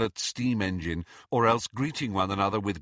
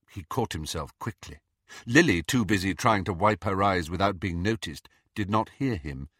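usual speaking voice

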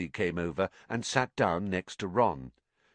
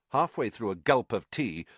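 usual speaking voice

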